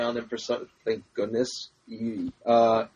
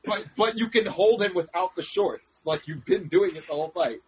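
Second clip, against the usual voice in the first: ordinary talk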